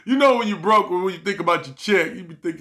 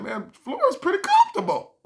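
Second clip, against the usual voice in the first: high, amused voice; mock content. Telling the audience the floor is comfy, high and pleased.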